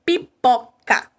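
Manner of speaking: "Pipoca" slowly in three beats; syllable by syllable. Shown as a demonstration. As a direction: sound out "Pipoca" as pi-po-ca with gaps. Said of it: "Eu tenho a emissão de três fonemas".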